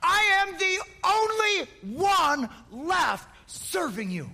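high, slow, crying voice; Elijah, near tears, high and slow; crying complaint. Acted. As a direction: break down as you finish the complaint. Elijah's self-pity.

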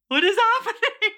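high-pitched shocked bystander; imitated alarm. Waiting room overhears her screams.

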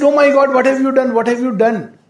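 dismayed outcry. Retold or imitated. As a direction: imitate a visitor crying out in dismay. Voicing a dismayed visitor's outcry.

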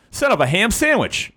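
high pained cry. Acted. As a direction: yelp it out after stubbing a toe. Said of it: "[name] cried out".

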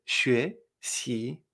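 "Xuexi" syllable by syllable, each rising; two separate questioning lifts on "Xuexi"; tones two-two. Shown as a demonstration. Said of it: "me extraño dos veces".

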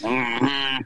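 low hummed grunt in character; Sling Blade. Acted. Cohost answering the question about his voice, Sling Blade grunt. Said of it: "Why are you doing the Sling Blade voice?".

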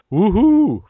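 high whoop from the cave; answering call. Acted. Reply heard from the cave, high loud whoop. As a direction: holler back loud. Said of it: "Then he hears".

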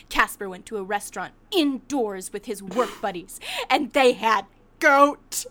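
hammed-up indignation, loud at the end; imitated upset. Friend reporting Casper's goat dinner, mock upset. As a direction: spell out the crime, slowing to a loud finish. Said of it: "You were so upset".

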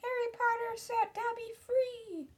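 shrill little elf, pitched very high; high, piping house-elf exclamation; Dobby. Acted. Freed elf gazes up at Harry, crying out shrilly. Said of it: "said the elf shrilly".

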